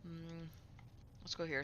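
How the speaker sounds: chewing while talking; mouth full. Real, not acted. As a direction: talk through a mouthful. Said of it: "Sorry, I'm chewing".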